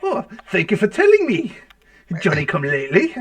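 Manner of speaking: put-on amateur Irish accent for a dwarf. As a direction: put on a shaky attempt at Irish. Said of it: "The, the Irish dwarf".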